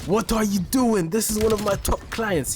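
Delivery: high-pitched mock scolding yell; imitated yelling. Recruiter phones to berate the texter, imitated yelling. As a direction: retell his outrage, pitch up throughout. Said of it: "He's yelling".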